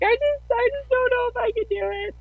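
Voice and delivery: retelling a panicked moment at Bible study, high and teary; high panicky wail. Retold or imitated. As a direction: voice shoots way up, near tears. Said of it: "I'm like".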